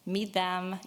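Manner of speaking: ordinary talk, nothing marked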